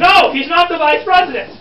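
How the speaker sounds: loud, high emphatic retort; exasperated correction. Pretend. Guide correcting the crowd on the election, exasperated. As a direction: set them straight forcefully.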